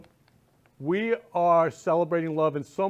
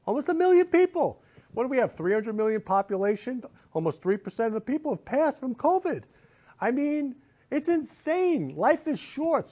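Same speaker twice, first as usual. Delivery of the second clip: worked up; 8 semitones higher than usual. Real, not acted. Upset about covid deaths.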